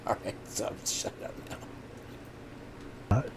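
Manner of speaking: hushed, breathy whisper after a laugh. Real, not acted. Streamer hushing his chat in a joking whisper. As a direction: drop to a secretive whisper.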